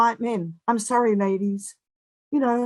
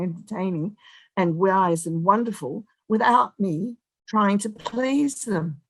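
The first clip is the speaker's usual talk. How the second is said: usual speaking voice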